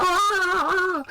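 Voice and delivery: very high-pitched mock scream; imitated scream. Retelling the beating scene, high imitated scream. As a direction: let out a long falsetto "aah".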